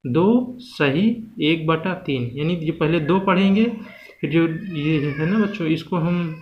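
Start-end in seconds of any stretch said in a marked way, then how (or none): 0.1-2.3 slow "दो सही एक बटा तीन", each word spaced; slow demo reading. Shown as a demonstration. Teacher showing students how to read a mixed fraction, slowly. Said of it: "इसको पढ़ा कैसे जाता है".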